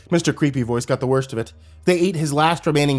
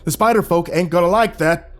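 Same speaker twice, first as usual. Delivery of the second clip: higher, louder panicked protest; alarmed protest; 5 semitones higher, 4 dB louder than usual. Acted. Old man protests alarmed.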